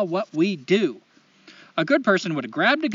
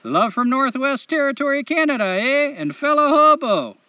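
higher, exaggerated Canadian lilt; Canadian accent; 7 semitones higher, 4 dB louder than usual. Host reading a listener's letter aloud, hammed-up Canadian accent. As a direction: put on a chipper, apologetic Canadian accent. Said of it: "in the best Canadian accent I know".

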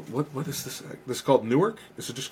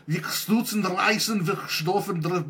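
put-on slow, higher made-up Dutch accent; mock Dutch; 5 semitones higher, slower than usual. Host hams up an invented Dutch toast, mock Dutch accent. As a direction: fake your way through Dutch gibberish. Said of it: "you sounded like you're fucking, uh, Dutch".

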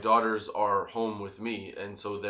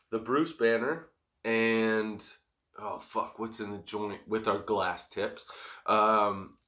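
ordinary talk, nothing marked

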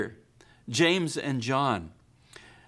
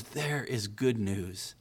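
slow tender announcement; 4 semitones lower, 5 dB quieter, slower than usual. Pretend. Angel's message, slow soft.

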